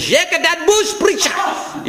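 very high mock scream in a Lithuanian accent; broken-English scream. Lithuanian listener in headphones cheering the preacher, screamed in broken English. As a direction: imitate an over-excited Lithuanian yelling in broken English. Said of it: "screamed so loud in services in his broken English".

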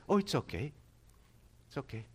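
mock shrug, trailing off quietly; dismissive brush-off. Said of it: "But not just".

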